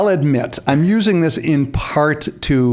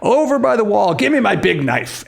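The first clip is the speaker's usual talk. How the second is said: higher, hammed-up melodramatic demand; mock dramatic threat; 7 semitones higher than usual. Acting out Ronnie's threat to a co-host, higher mock melodrama. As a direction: play the suicide threat as over-the-top melodrama. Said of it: "and then he threatens suicide".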